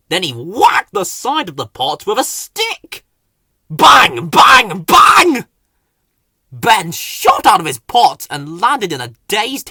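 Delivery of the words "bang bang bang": big shouted bangs, each one falling; loud, higher shouted bang bang bang. Acted. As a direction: shout each bang like a stick hitting a pot. Whacking the pot with stick.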